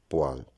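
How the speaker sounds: "poêle" as one syllable, PWAL; correct pronunciation. Shown as a demonstration. Teacher giving learners the right form, correctly pronounced pwal. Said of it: "la prononciation correcte est".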